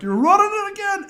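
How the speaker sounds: high, loud bellow of a furious coach; coach yelling. Retold or imitated. Coach yelling at the team to run again, loud and high. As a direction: bark it across the field. Coach orders another lap.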